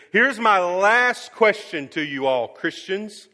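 loud, high, driving sermon delivery; fiery preaching. Acted. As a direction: preach it hard to the whole church. Preaching at full force while retelling.

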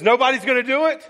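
shouted high and loud, fast; angry taunt. Retold or imitated. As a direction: sneer it at the audience. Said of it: "And he's just angry".

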